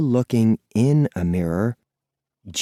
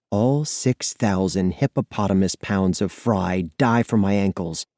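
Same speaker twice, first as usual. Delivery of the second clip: usual speaking voice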